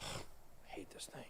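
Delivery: very soft, slow whisper; sigh, then whisper it slowly under your breath; grumbling whisper. Real, not acted. Host fed up with TradingView, grumbling in a slow whisper. Grumbling at his charting tool.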